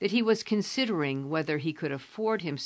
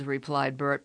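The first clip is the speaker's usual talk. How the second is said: ordinary delivery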